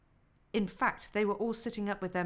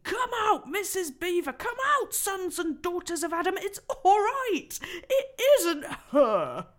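loud, high calling voice; excited beaver shouting; 10 semitones higher, 9 dB louder than usual. Acted. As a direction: summon everyone out with joy. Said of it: "he was shouting".

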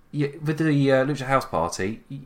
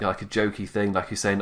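ordinary talk, nothing marked

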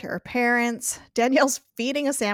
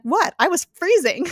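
raised, louder mock indignation; higher, defensive retold retort; indignant defence; 9 semitones higher, 6 dB louder than usual. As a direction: protest as if wrongly accused. Retold self-defence of single man.

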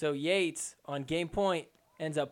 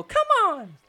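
mock pumped-up yell, much higher and louder; imitated shout; 14 semitones higher, 8 dB louder than usual. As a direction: shout it like a player firing herself up. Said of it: "She gives a little".

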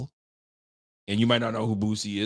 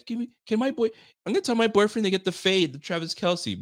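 high-pitched mock fangirl; eager Swiftie; 7 semitones higher than usual. Swiftie planning to tell her boyfriend about the fade, mock eager. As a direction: pitch it up like a gushing fan. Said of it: "The Swifties are all o-, they're all about it".

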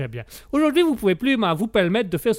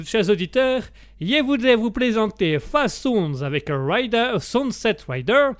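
put-on higher radio presenter with Italian-English accent; 4 semitones higher than usual. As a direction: announce English names slowly in a thick Italian accent. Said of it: "je fais bien l'accent anglais".

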